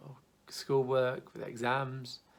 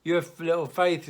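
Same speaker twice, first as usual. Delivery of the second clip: louder, higher exasperated telling-off; harsh scolding rebuke; 6 semitones higher, 10 dB louder than usual. Retold or imitated. Jesus rebuking sinking Peter, loud exasperated scolding. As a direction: scold him like a fool who failed. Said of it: "Oh, you idiot, you stuffed up".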